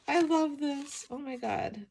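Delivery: high, loud burst of delight. Real, not acted. Flipping her art journal, thrilled by a page, high excited gush. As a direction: gush over the page she loves.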